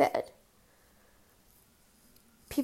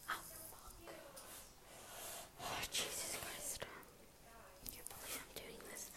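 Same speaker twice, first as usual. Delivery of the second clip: whispered grumbling; 3 semitones higher, 23 dB quieter than usual. Real, not acted. Muttering over the nails.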